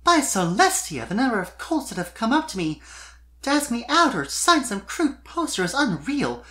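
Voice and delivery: put-on high, airy young filly voice, lifted well above the narrator; a gushing young pop star filly; Sweetie's voice. Sweetie complaining to Spike about fans, high filly voice.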